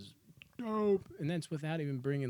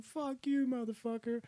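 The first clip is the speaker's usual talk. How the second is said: much higher, yelled curse at the sheriff; mock furious yell; 13 semitones higher than usual. Morgan cursing the sheriff in a high mock yell. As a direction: spit it out like a cornered man, pitch way up. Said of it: "And he's like".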